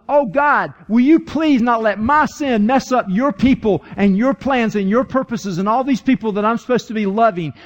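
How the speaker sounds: high, impassioned plea to God; preacher acting out David's urgent prayer; pleading prayer.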